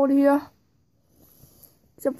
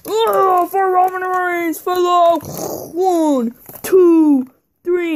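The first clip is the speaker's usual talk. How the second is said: high, loud, hammed-up wrestling call; The Rock; 5 semitones higher, 5 dB louder, slower than usual. Wrestler figure storms in for the win, loud play-acted shouting. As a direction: shout it out like a big match climax. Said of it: "here comes The Rock, and he's all like".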